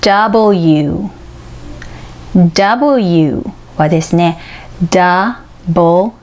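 W said slowly as ダブル ユー, with the L nearly silent; Wをゆっくりはっきり発音; English letter W. Shown as a demonstration. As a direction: sound out W carefully, teacher style. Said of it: "という三つの音節からできています".

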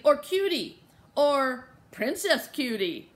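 put-on loud, drawn-out little-kid enthusiasm; eager child's suggestion. A narrator's reading. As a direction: savour each cute name slowly. Little child offering cute cat names.

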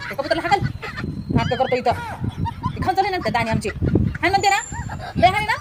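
put-on loud, scolding shouts at the boy; angry yelling. Wife yelling at the boy to chase pigeons, angry loud scolding. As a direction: bawl him out to scare the pigeons off. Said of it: "माझ्या पुढी लई जोरात बोलायलीस".

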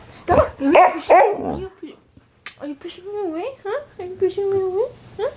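high, soft playful pet voice. Real, not acted. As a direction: tease the puppy gently. Playful baby talk to dog.

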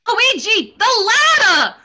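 desperate shout. Acted. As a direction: call out for help while dangling. Mario hanging from pipe.

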